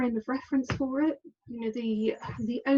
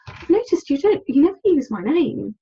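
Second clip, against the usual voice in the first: higher, louder challenge; pointed retold question; 5 semitones higher, 7 dB louder than usual. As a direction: push the complaint up high and loud. Confronting him about her name.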